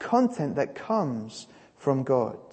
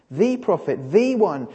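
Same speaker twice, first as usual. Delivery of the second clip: "the" said as "thee" both times; stress on THE; 3 dB louder than usual. Shown as a demonstration. Preacher explains God had promised someone, stressing each "the". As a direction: hit each "the" as an emphatic "thee". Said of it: "he calls him the prophet".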